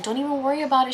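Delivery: a hint of a gentle stranger; sweet lady voice.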